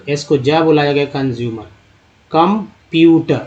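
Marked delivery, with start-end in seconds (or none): 2.3-3.4 कंप्यूटर said slowly, syllable by syllable; slow sounding out. Shown as a demonstration. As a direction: stretch out कंप्यूटर so each part of the word is clear. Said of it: "teacher sounding out the word".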